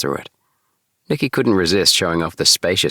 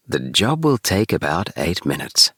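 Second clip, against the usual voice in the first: lightly voiced machine announcement; a hint of an appliance reading out its status. A narrator's reading. The Easy Bake announces the job, a hint of machine voice.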